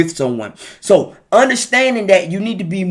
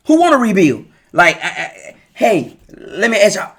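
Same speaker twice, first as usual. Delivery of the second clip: ordinary talk, nothing marked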